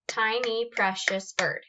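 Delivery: "Ti-ny pre-cious bird", each syllable its own beat. Shown as a demonstration. Teacher counting five syllables of "Tiny, precious bird", evenly separated. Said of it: "how many times am I clapping".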